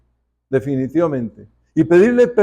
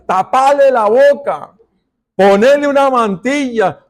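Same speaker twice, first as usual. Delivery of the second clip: frantic parent; 7 semitones higher, 4 dB louder than usual. Acted. Parent voiced hushing crying baby.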